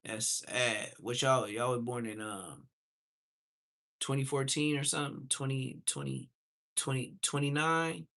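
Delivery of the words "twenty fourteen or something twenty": ordinary talk, nothing marked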